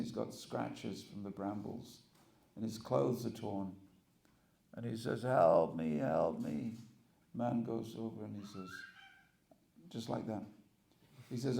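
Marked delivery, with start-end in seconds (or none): 5.2-6.8 lower, louder cry for help; a hammed-up plea for help; desperate plea. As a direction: call out like a lost man at the end of his strength. Lost hunter begging.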